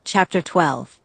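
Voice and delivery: usual speaking voice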